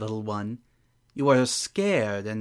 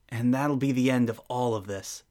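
ordinary talk, nothing marked

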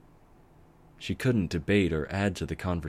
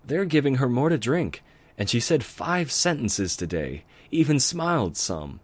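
higher and louder, eager; excited news; 8 semitones higher, 5 dB louder than usual. Acted. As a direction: bubble over with the good news. Twilight sharing good news.

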